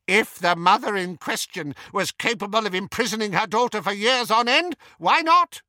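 fast, high, off-hand; careless shrug. A narrator's reading. Aunt shrugging off murder, high careless toss. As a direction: toss it off like it's nothing. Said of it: "shrugged Auntie Muriel".

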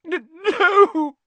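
loud, high, stammering sob; sobbing. A narrator's reading. Lavender answering about her dead rabbit, sobbing. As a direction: sob the word out in a wail. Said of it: "sobbed Lavender".

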